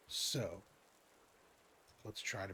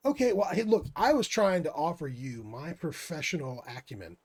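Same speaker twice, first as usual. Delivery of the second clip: indignant; 7 semitones higher, 13 dB louder than usual. Acted. Player protests the guard's refusal.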